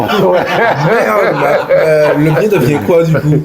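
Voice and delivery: high, amused voice through laughter; laughing. Real, not acted. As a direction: ask it while cracking up. Interviewer laughs at the capotée sale.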